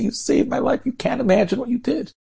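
very high-pitched, gushing thanks; overjoyed gratitude. Acted. Rescued men embracing their air traffic controller, overjoyed gratitude. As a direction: pour out thanks, pitch soaring.

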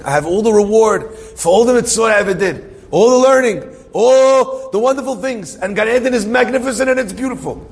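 high-pitched, swelling wonder; rapturous. Retold or imitated. Friend describes Gan Eden in a dream, rapturous. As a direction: marvel at it in a retelling.

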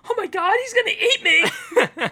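high, loud panicked shriek; hammed-up scream of a scared passer-by; mock terror. Acting terrified people who stare.

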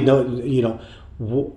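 usual speaking voice

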